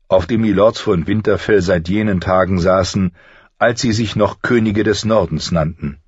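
plain reading voice

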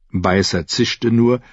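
plain reading voice

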